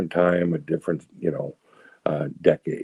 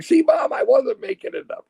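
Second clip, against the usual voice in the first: very high-pitched, told-you-so cry; vindicated son; 3 dB louder than usual. Retold or imitated. Son proving his story to his mom, vindicated. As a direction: crow it to mom, voice shooting up. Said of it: "I'm like".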